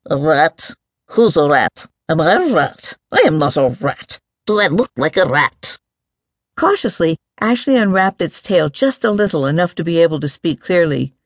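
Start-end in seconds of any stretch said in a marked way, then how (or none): 0.1-5.8 lightly voiced mole, mildly affronted; a touch of offended grumbling; loud mumble. A narrator's reading. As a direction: mumble it out indignantly. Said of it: "the voice mumbled loudly".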